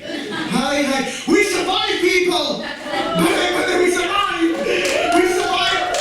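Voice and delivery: high, loud, laughing cheer of survival; triumphant shout. Acted. Comedian cheering to the audience that covid is over, loud high shout. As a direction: shout it to the room like a victory.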